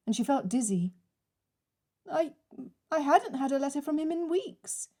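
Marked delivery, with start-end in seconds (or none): 2.1-4.9 stammered and raised, struggling to speak; faltering, barely managing. Acted. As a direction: force the words out through shock. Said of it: "she managed".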